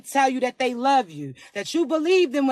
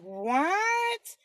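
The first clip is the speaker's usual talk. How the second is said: flirty, higher-pitched delighted gushing; 4 semitones higher than usual. Acted. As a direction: slide up high as if flattered and showing off. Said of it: "hitting them high notes like [name]".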